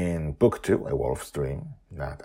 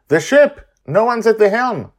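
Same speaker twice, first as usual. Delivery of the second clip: high, loud alarmed cry; panicked shout; 16 dB louder than usual. Acted. Panicked cry while flailing.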